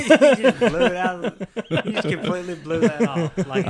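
high, loud talk through laughter; can barely get words out for laughing; real laugh while teasing a friend. Real, not acted. Cracking up at the question.

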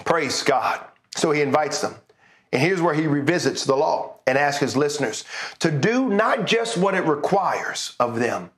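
ordinary talk

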